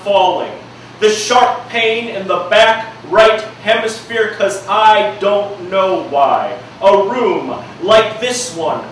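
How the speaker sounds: rhythmic slam poetry delivery, punching key words; spoken word poem. Acted. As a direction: perform it like a slam poem, building intensity. Performs his spoken-word poem.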